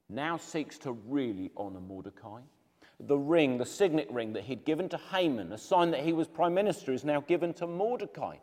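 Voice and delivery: usual speaking voice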